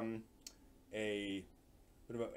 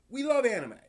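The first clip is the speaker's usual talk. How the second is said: higher, louder, gushing; excited burst; 11 semitones higher, 8 dB louder than usual. Real, not acted. Streamer gushing to chat about anime, excited. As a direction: punch out the love for anime.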